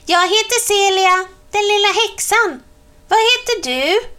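put-on high, fast little girl voice; little witch girl. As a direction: play a tiny witch girl introducing herself. Celia introducing herself to Per Johan.

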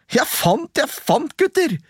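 high, eager sing-song call; a boy crowing over his find. A narrator's reading. Askeladden calling to his brothers, high excited cry. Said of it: "ropte han".

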